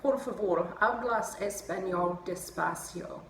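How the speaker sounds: slow, careful Spanish request; a polite learner's slow Spanish. Shown as a demonstration. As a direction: spell out each Spanish word slowly. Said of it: "I've also learned to say".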